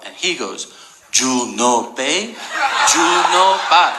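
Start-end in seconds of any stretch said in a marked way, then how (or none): 1.1-4.0 slow, drawn-out mock accent with attitude and a laugh; parking attendant sneering back in broken English, slow with attitude. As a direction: lean into it, dismissive and slow. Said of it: "Like, with attitude".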